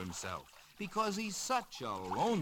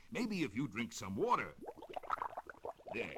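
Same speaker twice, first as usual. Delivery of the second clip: ordinary delivery, nothing marked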